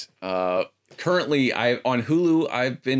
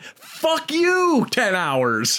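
exasperated outburst; much slower than usual. Real, not acted. Mock outrage at fan advice.

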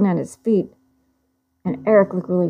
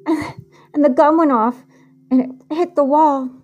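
higher, shaky with tears, on the edge of crying; frightened, tearful retelling; 7 semitones higher than usual. Acted. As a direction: push through the fear as you relive it. Retelling a violent assault, distressed.

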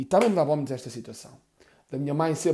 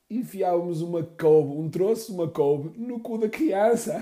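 slow, high, through laughter; mother laughing out the punchline; 4 semitones higher, 6 dB louder, slower than usual. Retold or imitated. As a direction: crack up on the punchline.

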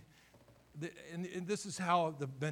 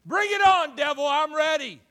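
loud, high shout at the devil; ready for a fight, yelling; defiant shout; 13 semitones higher, 16 dB louder than usual. Acted. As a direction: dare the devil to come. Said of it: "in our vernacular today, he said it".

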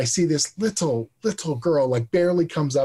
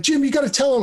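mock excited fans; 12 semitones higher, faster than usual. Fans urging Jim about the ride series, giddy high pleading. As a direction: play the fans' giddy begging.

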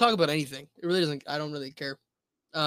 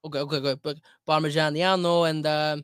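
very Italian American accent; 4 dB louder than usual. Shown as a demonstration. Food show host introducing a recipe, mock Italian accent. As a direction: play up the Italian accent on every word. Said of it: "you're an Italian American, very Italian American".

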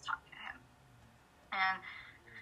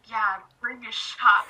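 louder laughing blurt; 11 dB louder than usual. Retold or imitated. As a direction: laugh through the line.

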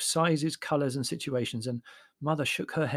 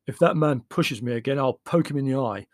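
angry; 5 dB louder than usual. A narrator's reading. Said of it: "his face flushed red".

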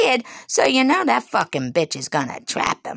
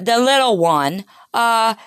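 usual speaking voice

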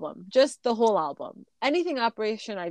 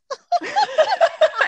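high shrieking laugh; 7 dB louder than usual. Real, not acted. Reacting to corpse song topic.

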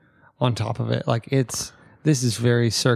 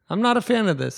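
exasperated telling-off; 9 semitones higher, faster than usual. Retold or imitated. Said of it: "I've told Instagram".

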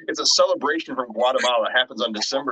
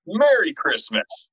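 slow, higher mock-cheery Merry Christmas; drawn out with dark irony; ironic greeting; 7 semitones higher, much slower than usual. Grim story, ironic holiday sign-off.

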